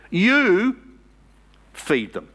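You punched high and loud, then feed them; stress on You. Shown as a demonstration. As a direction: hit the word You hard. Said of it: "It's an emphatic you".

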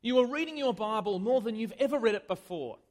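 usual speaking voice

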